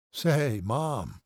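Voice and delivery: drugged slur, drawn out; slurred. A narrator's reading. Drugged son greeting his mother, slurring. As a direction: slur it, doped on painkillers. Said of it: "Paul slurred".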